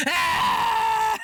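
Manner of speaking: loud high shriek of fright; startled scream. Real, not acted. Jumping at a game scare with a loud shriek.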